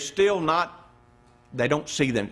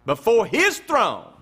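hushed scripture reading; 7 semitones lower than usual. Acted.

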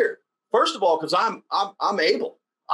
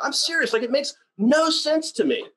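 ordinary talk, nothing marked

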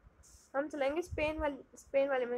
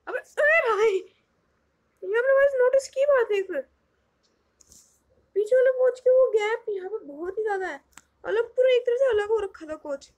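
loud, high burst of surprise; startled outburst; 7 semitones higher, 8 dB louder than usual. Real, not acted. Gamer spots a huge gap between the train coaches, startled. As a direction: exclaim in real shock, high and loud.

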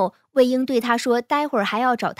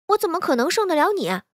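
higher, disbelieving outburst; blurted, higher-pitched protest; 6 semitones higher than usual. A narrator's reading. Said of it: "金陵脱口而出".

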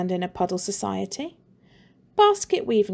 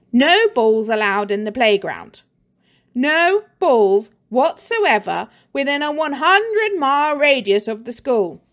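louder, higher barking decree; stern teacher; 3 semitones higher, 6 dB louder than usual. Acted. Mister Pent's warning signs read out sternly, louder. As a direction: lay down the law like a cross headmaster.

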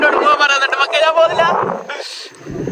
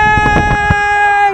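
calling out; 6 semitones higher, much slower than usual. Real, not acted. Calling out at the mist.

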